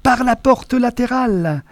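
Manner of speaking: calling out. A narrator's reading. Chase, calling directions.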